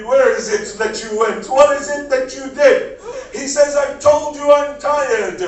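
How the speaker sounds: loud, high wailing sermon cry; preaching at full force. Acted. Majlis recitation at full force.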